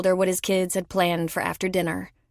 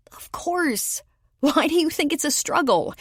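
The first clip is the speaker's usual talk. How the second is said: much higher, incredulous; exasperated Lola; 9 semitones higher than usual. Acted. Lola answers Tom, high and exasperated. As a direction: throw it back at him.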